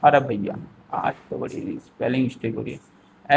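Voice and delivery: usual speaking voice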